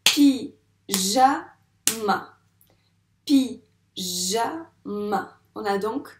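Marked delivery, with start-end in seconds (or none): 0.0-1.5 "Pyjama" loud, split pi... ja... ma with pauses; very slow, emphatic pi, ja, ma; syllable by syllable. Shown as a demonstration. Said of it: "je dis chaque syllabe". 3.2-5.3 "Pyjama" split pi... ja... ma with pauses; teacher separating pi, ja, ma; syllable by syllable. Shown as a demonstration. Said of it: "trois syllabes".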